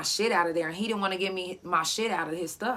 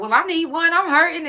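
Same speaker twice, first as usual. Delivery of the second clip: high, louder mock complaint of pain; mock pained whine; 10 semitones higher, 9 dB louder than usual. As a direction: whine it like a hurting man fishing for a massage. Retold boss asking for massage.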